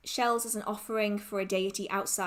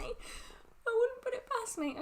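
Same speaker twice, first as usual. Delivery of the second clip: teary; 13 semitones higher, 4 dB quieter, slower than usual. Real, not acted. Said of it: "I might cry".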